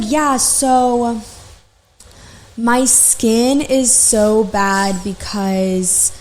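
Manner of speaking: very drawn-out imitation, long pauses; talking really slow. Imitating facial client blaming her husband, very slow. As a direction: drag each word out like the odd client. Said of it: "talking really slow".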